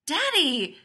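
put-on high, loud child's cry, falling; alarmed child. A narrator's reading. Joe interrupting Leonard on spotting danger, alarmed child's cry. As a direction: call out to Daddy in alarm.

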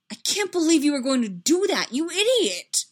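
loud, high-pitched exasperated outburst; upset scolding. A narrator's reading. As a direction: scold a friend in disbelief. Anakin scolds friend after punch.

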